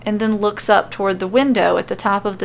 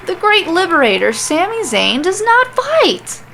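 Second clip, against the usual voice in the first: rising pitch; 9 semitones higher, 3 dB louder than usual. Acted. Sami refuses to fight the referee, climbing ever higher. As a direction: climb into a shriek. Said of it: "His voice is getting more and more high-pitched".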